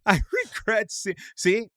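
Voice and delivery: high, teasing parrot of his words; high mocking echo. Mimics friend's line, laughing.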